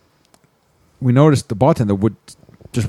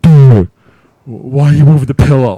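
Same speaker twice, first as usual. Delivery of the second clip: put-on loud drunken yell, raised pitch; retold yelling; 4 semitones higher, 12 dB louder than usual. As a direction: yell it at your friend. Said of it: "then she starts yelling at me".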